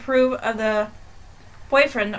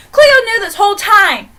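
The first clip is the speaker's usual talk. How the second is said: higher and louder, a worked-up rant; outraged; 9 semitones higher, 7 dB louder than usual. Real, not acted. Telling viewers how the family hid the truth, outraged. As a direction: vent at the family that hid everything.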